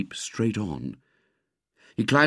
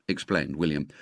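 plain reading voice

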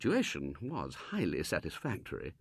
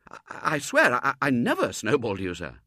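higher, louder, insistent denial; stammering Robert; 4 semitones higher, 7 dB louder than usual. Acted. Robert denying the snowball, stammering and insistent. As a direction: protest your innocence.

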